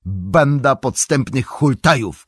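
hammed-up cry at the crafty rascals; a playful exclaim, drawn out; mock shout. A narrator's reading. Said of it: "krzyknął".

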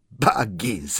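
mildly joyful exclamation; delighted call. A narrator's reading. Wizard overjoyed to see the hobbit, mild glad call. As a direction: greet him with a touch of delight. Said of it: "zawołał".

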